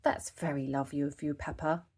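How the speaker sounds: a hint of a gentle father; lightly voiced Daddy Pig. A narrator's reading. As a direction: kind dad talking to his little girl. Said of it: "said Daddy Pig".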